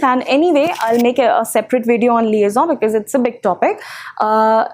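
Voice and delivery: usual speaking voice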